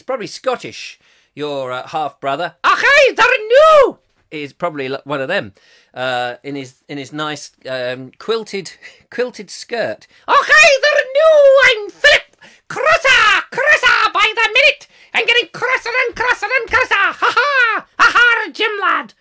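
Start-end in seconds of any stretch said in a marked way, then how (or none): 2.6-4.0 very high, loud Scottish pirate cry. Acted. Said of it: "He's a Scottish, arr". 10.3-19.1 very high, loud Scottish pirate shouting and cackling; a hammed-up Scots pirate roaring with glee. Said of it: "Oh, he's a pirate".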